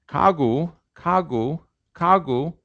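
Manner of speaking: kagu with voiceless k first and voiced g in the middle; Korean kagu. Shown as a demonstration. Teacher drilling the Korean word kagu. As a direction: start kagu with a k, soften the middle into g. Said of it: "it's pronounced kagu".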